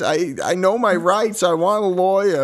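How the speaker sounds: high, drawling Southern country voice; a nerdy Southern suspect pleading; southern accent. Pretend. Podcast host voicing Oswald's arrest in a Southern accent. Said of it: "Trying to find my southern accent again".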